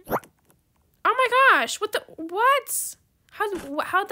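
high, loud what; startled disbelief; shocked. Real, not acted. Gamer startled by a sudden teleport, shocked.

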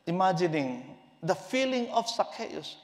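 ordinary delivery

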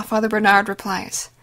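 ordinary delivery

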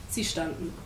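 ordinary delivery

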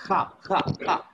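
"Kha, kha" breathed out from the throat; visarga from throat. Shown as a demonstration. Teacher demonstrating visarga, throat sound. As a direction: push each "kha" from the throat, separately. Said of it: "It will be from the kanda".

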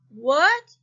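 called out loud, puzzled; a loud confused question; loud puzzled what. A narrator's reading. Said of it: "he asked".

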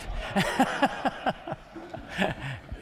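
a high airy burst of laughter; high breathy laugh. Real, not acted. Interviewer laughing after reading the glowing quote, high and airy.

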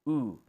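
او held as a long rounded oo; long oo sound. Shown as a demonstration. Teacher models the long oo for students. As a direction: stretch out the oo vowel. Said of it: "منطقة او".

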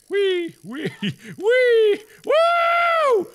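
high squeals building to a whoop; playful whooping. Pretend. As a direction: whee like a kid on a ride. Whooping while launched in game.

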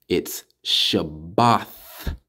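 very slow Shabbath with a th at the end, shown as right; correct form. Shown as a demonstration. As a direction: Shabbath set against Shabbat, ending th not t. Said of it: "That's how you say Sabbath".

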